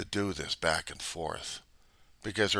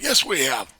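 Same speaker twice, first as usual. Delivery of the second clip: louder, higher contradiction; mock retort; 5 semitones higher, 8 dB louder than usual. Denier contradicting a doubter, raised mock voice. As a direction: snap back loudly in a mock quarrel. Acted quarrel, denier's side.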